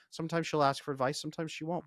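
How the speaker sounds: usual speaking voice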